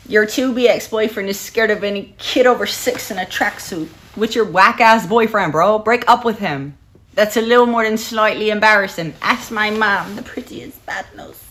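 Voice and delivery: loud, high-pitched mock Irish accent. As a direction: belt it out as a playful Irish impression. Trying out her Irish accent.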